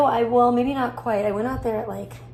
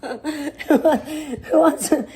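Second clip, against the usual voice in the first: louder, breaking up with laughter; laughing; 4 semitones higher, 5 dB louder than usual. Real, not acted. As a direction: fight through the giggles. Repeats her joke line laughing.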